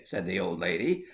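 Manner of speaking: ordinary delivery, nothing marked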